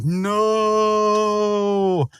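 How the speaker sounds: long, high drawn-out wail at normal volume; mock anguished cry. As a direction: stretch out a hammed-up cry of despair. Rigby watches his burger being eaten.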